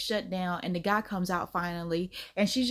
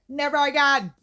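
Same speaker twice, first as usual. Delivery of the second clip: higher and louder, emphatic vow; 5 semitones higher, 7 dB louder than usual. Shown as a demonstration. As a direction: belt out the vow.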